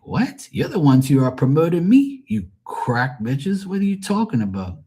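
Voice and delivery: retold outburst. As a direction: fire back at the women who dissed him. I'm like.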